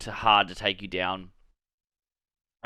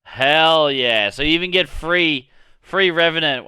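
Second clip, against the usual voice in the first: real hype, higher and louder; excited cheer; 6 semitones higher, 6 dB louder than usual. Real, not acted. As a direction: pitch up and louder, pumped about the news. Hyped reaction to free Revenant.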